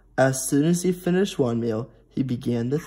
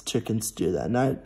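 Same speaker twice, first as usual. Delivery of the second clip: plain reading voice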